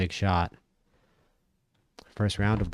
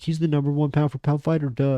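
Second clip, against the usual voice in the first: higher, smug know-it-all fan voice; mocking fan hype; 6 semitones higher, 4 dB louder than usual. Mocking boxing fans who crown Inoue, higher and smug. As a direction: parody the fans crowning Inoue, duh tacked on. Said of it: "whenever anyone sees Inoue fight in real life, like".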